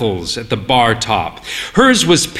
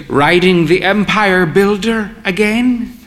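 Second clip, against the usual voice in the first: put-on higher proud old lady; an old mother teasing her son, higher; 6 semitones higher than usual. A narrator's reading. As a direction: tease him as the grand old mother.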